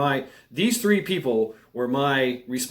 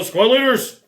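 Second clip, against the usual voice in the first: loud, high summons to the squad leaders; acted call-out, pitched up and loud; mock sergeant's shout; 11 semitones higher, 8 dB louder than usual. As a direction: imitate a sergeant bellowing for his men. Staff sergeant calling out, acted.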